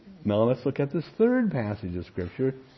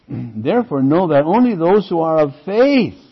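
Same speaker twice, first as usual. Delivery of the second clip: louder reading that lands on "faith"; stress on faith; 4 semitones higher, 9 dB louder than usual. Shown as a demonstration. The preacher reads Galatians aloud, pressing "faith". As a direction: punch "faith" as the key word. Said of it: "You see the emphasis here?".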